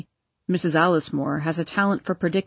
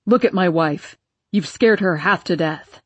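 angry; 2 semitones lower, 3 dB louder than usual. Acted. Enraged father shouting at the woman.

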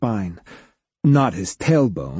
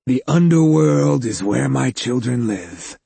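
low creepy voice. A narrator's reading. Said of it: "in a low voice that made Hugo's skin crawl".

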